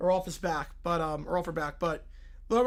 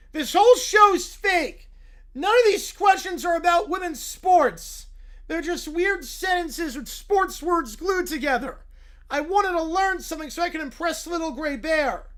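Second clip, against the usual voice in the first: high, louder indignant whine; whiny kid; 8 semitones higher, 7 dB louder than usual. Acted. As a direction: protest like a cheated child. Kid character complaining.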